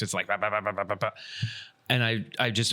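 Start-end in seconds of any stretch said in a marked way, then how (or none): 0.3-1.1 rapid spoken gunfire noise; imitated gun bursts. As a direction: rattle off "bah" like automatic fire. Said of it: "it's, like, three four-round bursts".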